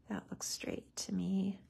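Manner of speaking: soft whispered check; whispered to self. Real, not acted. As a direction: murmur it under your breath. Checking alignment, talking to self.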